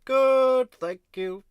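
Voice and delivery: imitating through a mouth stuck open; very high mimic with mouth stuck open. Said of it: "I can't close my mouth".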